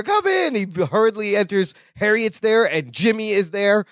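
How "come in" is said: high squeaky welcome; high-pitched. Retold or imitated. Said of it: "opens the door".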